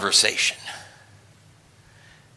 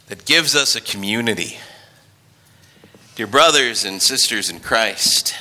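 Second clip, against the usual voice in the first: ordinary talk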